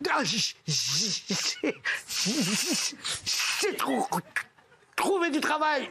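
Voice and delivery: slow stammering j- sounds; stuttering Charlie. Acted. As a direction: choke on j- before you get it out. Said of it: "un mec qui bégaye".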